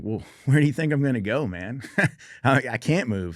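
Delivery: wounded soldier laughing at a silly order; laughing retort. Retold or imitated. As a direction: laugh it off like an obvious joke. Joking retort while wounded.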